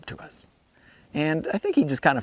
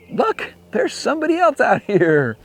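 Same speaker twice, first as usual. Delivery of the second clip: higher, louder excited spotting with a laugh; surprised call; 10 semitones higher, 6 dB louder than usual. Retold or imitated. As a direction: point out a stranger in the empty desert. Spotting a stranger in desert.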